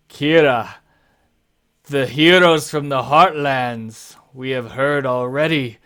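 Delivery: lightly voiced big host, loud at first; big man. Big man hailing heroes from the Heartlands, lightly acted. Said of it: "the big man says".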